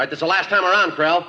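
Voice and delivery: shouted final warning; calling out. Acted. Last warning through door.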